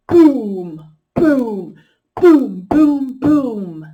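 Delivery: big loud booming shouts; drum-like shouted booms. Shown as a demonstration. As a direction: act out a loud animal, boom it big. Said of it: "It's a loud one".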